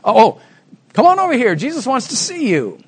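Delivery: high, eager calling over; suddenly welcoming. Retold or imitated. Crowd now calls the blind man to Jesus, suddenly welcoming. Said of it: "there's a change in the tenor of their voice".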